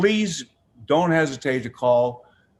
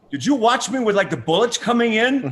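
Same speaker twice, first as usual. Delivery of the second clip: much higher, louder, laughing fan driver; excited driver; 11 semitones higher, 6 dB louder than usual. Retold or imitated. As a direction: play the thrilled driver reliving the bullets. Retold Afghan driver, excited.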